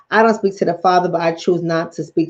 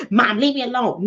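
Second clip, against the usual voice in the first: higher-pitched mock whine to mom; imitating exasperated son; 6 semitones higher than usual. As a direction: beg mom to drop it, like an embarrassed kid.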